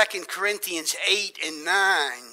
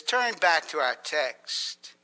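ordinary delivery

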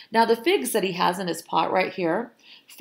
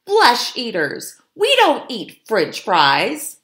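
loud, higher, indignant yell; indignant scream; 5 semitones higher, 7 dB louder, slower than usual. A narrator's reading. Meat-eating fox refusing French fries, loud indignant scream. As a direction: yell it emphatically, word by word. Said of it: "screamed Flo".

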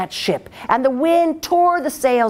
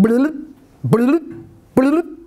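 rising, cartoonish bloops; toad noises; 3 semitones higher, much slower than usual. A narrator's reading. Toad bloops across the emperor's floor, cartoonish. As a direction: make the toad's silly hopping sound.

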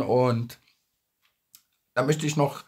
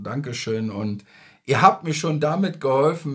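ordinary talk, nothing marked

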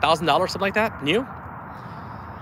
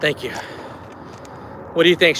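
usual speaking voice

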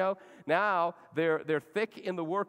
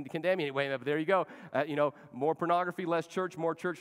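ordinary talk

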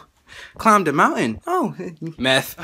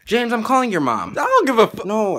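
ordinary talk

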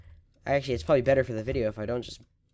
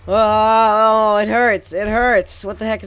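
loud, higher overacted moaning; milk the pain for the camera; overdone whine; 8 semitones higher, 11 dB louder, much slower than usual. Gamer hamming up his frustration for viewers, loud whining. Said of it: "I was overreacting".

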